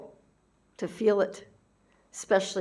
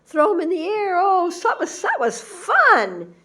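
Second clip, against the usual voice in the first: put-on a giddy little kid tearing up tissues; playful child voice; 12 semitones higher, 5 dB louder than usual. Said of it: "It's like".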